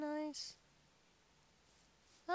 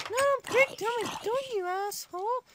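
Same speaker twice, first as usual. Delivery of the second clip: raised-voice scolding; loud protest; 3 semitones higher, 6 dB louder, faster than usual. Real, not acted. Player telling Tommy off, loud protest. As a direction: shout them off.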